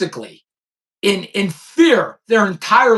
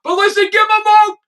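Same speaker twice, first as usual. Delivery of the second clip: loud, high, hammed-up endorsement; mock excited plug; 11 semitones higher, 3 dB louder, faster than usual. Real, not acted. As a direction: sell it with mock enthusiasm.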